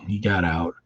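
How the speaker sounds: ordinary talk, nothing marked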